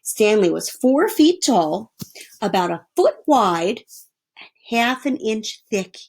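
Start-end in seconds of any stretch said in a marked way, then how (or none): none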